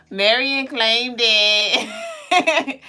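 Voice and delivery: laughing through the words; slow words through a loud laugh. Real, not acted.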